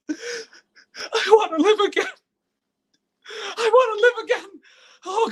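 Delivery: high, sobbing plea; tearful plea. Acted. Desperate man praying to live again, sobbing. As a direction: beg through tears to live. Prayer to return, crying.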